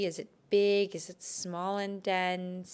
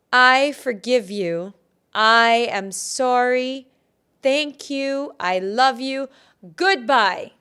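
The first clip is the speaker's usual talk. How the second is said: much louder, emphatic, rising in pitch; forceful affirmation; 4 semitones higher, 11 dB louder, slower than usual. Shown as a demonstration. Healer does the release over again, loud forceful affirmation. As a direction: proclaim each phrase loudly, with shorter pauses.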